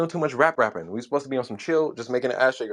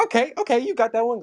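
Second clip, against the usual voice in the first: high-pitched imitation of a rival; retold grudging praise; 10 semitones higher than usual. Jealous cousin conceding a song, high imitated voice. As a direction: concede the win in a raised mimicking voice. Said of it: "So he's like".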